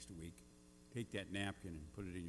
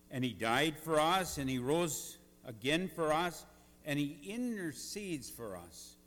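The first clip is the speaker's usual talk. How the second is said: booming motivational speech; 5 semitones higher, 9 dB louder than usual. Acted. Preaching at full force.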